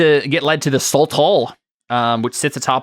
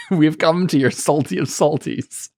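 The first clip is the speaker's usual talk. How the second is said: mocking knight; 5 semitones higher than usual. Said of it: "this knight is saying".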